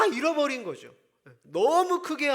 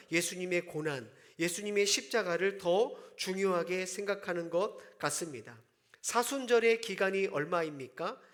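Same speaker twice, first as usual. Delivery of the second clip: a bit softer, even reading voice; letter read aloud; 2 semitones lower, 4 dB quieter than usual. Acted. Reads another pastor's letter. (편지를 차분히 낭독하듯)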